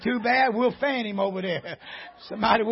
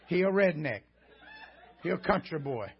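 mock pity; 6 semitones lower, 6 dB quieter than usual. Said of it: "you just say".

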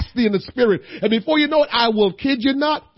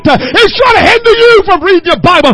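crying preacher's shout; 8 dB louder than usual. Acted. Preacher commanding the church to destroy evil thoughts, crying out. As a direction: hammer each line with a tearful shout. Building back to full force.

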